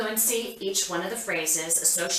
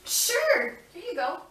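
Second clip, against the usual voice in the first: excited praise; 13 semitones higher than usual. Acted. Therapist rewarding child.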